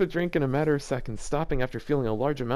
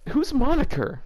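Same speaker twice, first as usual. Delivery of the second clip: confused; 11 semitones higher, 5 dB louder than usual. Real, not acted. Confused about the character.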